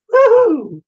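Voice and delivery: high, loud cheer of delight; excited whoop. Real, not acted. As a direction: whoop for the free reading. Cheering the free reading.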